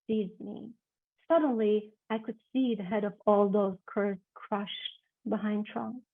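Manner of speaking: expressive literary reading, swelling to loud climaxes; dramatic reading. Acted. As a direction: read the passage with rising drama. Author reads her novel excerpt.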